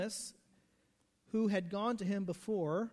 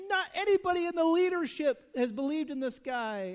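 high, louder scornful jab; mocking outrage; 8 semitones higher, 7 dB louder than usual. Preacher mimicking frustrated Pharisees, higher and louder. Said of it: "They're so frustrated".

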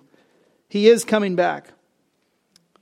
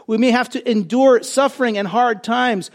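usual speaking voice

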